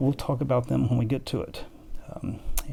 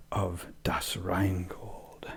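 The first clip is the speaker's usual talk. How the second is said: whisper; 4 semitones lower, 7 dB quieter, much slower than usual. Real, not acted. Unvoiced ending.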